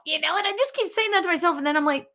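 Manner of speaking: ordinary talk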